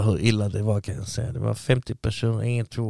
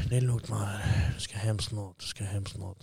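quiet self-reassurance; 7 dB quieter than usual. Retold or imitated. Reassuring himself among prisoners.

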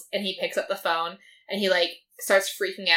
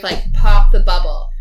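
mock demand; 15 dB louder, slower than usual. Demanding truth, retold quote.

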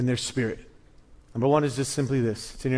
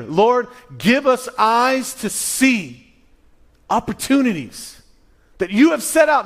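fervent preaching; 8 semitones higher, 5 dB louder than usual. Acted. Preaching at full force.

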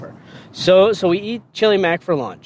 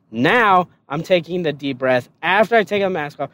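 ordinary talk, nothing marked

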